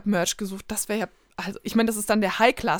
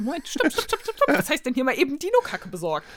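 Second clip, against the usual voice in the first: incredulous; 5 semitones higher than usual. Retold or imitated. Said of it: "ich dachte so".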